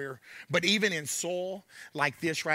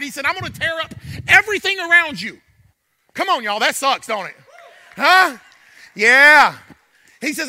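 loud, higher, driving sermon voice; preaching at full force; 7 semitones higher, 11 dB louder than usual. Acted. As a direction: preach it hard, voicing God's words with fire.

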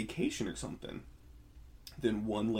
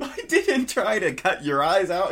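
louder, higher through laughs; laughing; 8 semitones higher, 12 dB louder than usual. Real, not acted. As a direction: laugh it out to your partner. Joking with partner.

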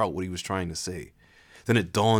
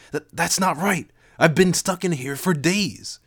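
shocked anger; 10 semitones higher, 4 dB louder than usual. Acted. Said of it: "shock and anger".

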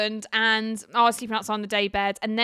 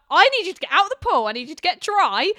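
bossy producer; 8 semitones higher, 6 dB louder than usual. Retold or imitated. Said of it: "And she was like".

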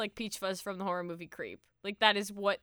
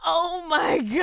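overcome with laughter; 5 semitones higher, 5 dB louder than usual. Real, not acted. Still recovering from hard laughter.

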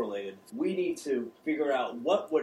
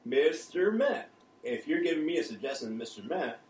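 ordinary talk, nothing marked

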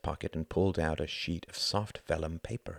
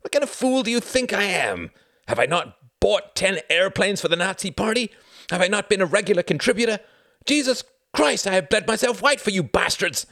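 outraged protest rising to high shouting; indignant cry; 14 semitones higher, 8 dB louder than usual. A narrator's reading. The Count denies being a fascist, indignant crying out. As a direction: build from disbelief to loud, high outrage. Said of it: "cried Count Orski".

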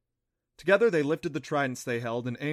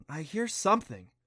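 hesitant; 4 semitones higher than usual. A narrator's reading. Clancy to Adam, a hesitant repeat. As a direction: say it again, doubting yourself. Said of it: "Clancy repeated hesitantly".

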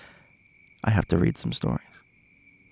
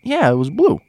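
mock witness voice; 9 semitones higher, 3 dB louder than usual. Other witness agreeing, acted voice.

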